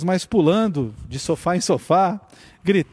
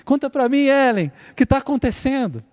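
higher playful puppet-snake voice; snake character voice; 7 semitones higher than usual. Retold or imitated. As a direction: voice the pet snake puppet gently. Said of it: "imitar a cobra e falando com a vozinha do personagem".

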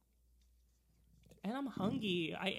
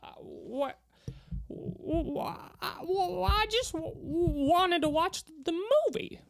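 high stammering impression, building louder; halting, high-pitched old-movie star imitation; Jimmy Stewart; 8 semitones higher, 5 dB louder than usual. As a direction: stutter on every W, then push through. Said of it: "me doing an impression".

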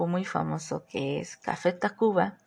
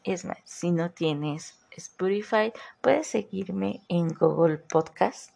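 normal talking voice

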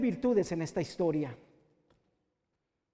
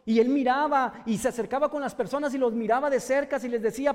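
louder, higher impassioned preaching; preaching at full force; 6 semitones higher, 6 dB louder than usual. Acted. Preacher tells the congregation of Bartimeo, fervent loud preaching. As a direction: build the sermon to a fervent climax.

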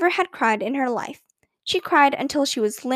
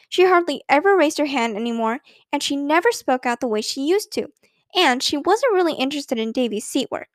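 ordinary delivery

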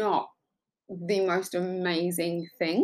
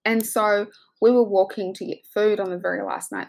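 ordinary delivery

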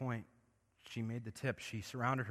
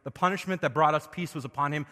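loud, high, building revival sermon; full-force preaching; 4 semitones higher, 9 dB louder than usual. Acted. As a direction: preach it out with rising fire. Preaching climax over scripture.

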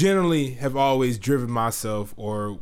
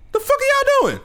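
high, put-on indignant voice; imitated angry friend; 3 dB louder than usual. Telling friends how the roommate walked in, mock indignant. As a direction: play the friend barging in annoyed.

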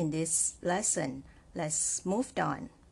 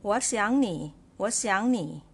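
我想你 run together in one smooth phrase, louder; read together; 3 semitones higher, 4 dB louder than usual. Shown as a demonstration. Teacher showing learners the smoother reading, 我想你 joined up. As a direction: join 我想你 without a pause, 想 rising. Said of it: "if you want to read it together".

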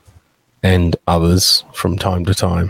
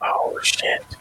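whispered; 8 dB quieter than usual. Real, not acted. Realises his audio is bad.

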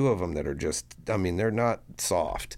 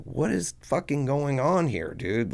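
high-pitched, panicky disbelief; retold freaking out; 8 semitones higher than usual. To his brother during the raid, retold panic. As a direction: let the pitch jump up as you demand answers. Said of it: "I'm freaking the fuck out".

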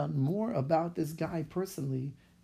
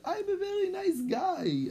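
much higher, sugary plea; mock sweet plea. Rabbi sweet-talks the secretary on the phone, high mock plea. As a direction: sweeten it up to charm her.

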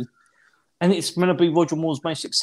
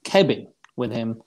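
usual speaking voice

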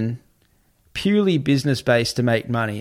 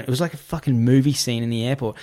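ordinary talk

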